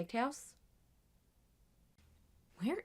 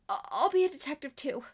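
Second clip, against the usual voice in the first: much louder, fierce declaration; loud vow; 3 semitones higher, 9 dB louder than usual. Acted. Volunteering as detective for Gangle, loud determined vow. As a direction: shout it as a promise.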